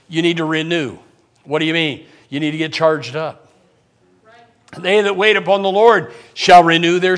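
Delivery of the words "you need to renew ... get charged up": usual speaking voice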